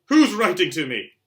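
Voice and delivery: loud, slow, high cry; boy yelling at his uncle; shouted question. Acted. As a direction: demand an answer at full voice. Harry demanding his letter.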